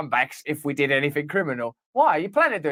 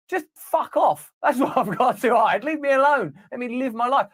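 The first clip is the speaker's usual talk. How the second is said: exasperated; 7 semitones higher than usual. Acted. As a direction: snap back, fed up. Acted reply to snoopers.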